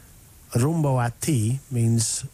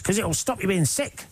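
put-on Kiwi accent; 9 semitones higher than usual. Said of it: "It's your best Kiwi accent".

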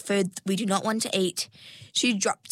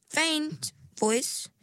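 faint said higher and breathier, set apart; stress on faint; 8 semitones higher than usual. A narrator's reading. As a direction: lift and stress the word faint. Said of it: "She emphasized the word faint".

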